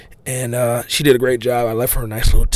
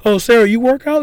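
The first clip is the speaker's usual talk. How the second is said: high, playful teasing question; teasing buddy voice; 12 semitones higher, 4 dB louder than usual. Retold or imitated. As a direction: tease her like a cheeky friend. Retold teasing quote from Clarence.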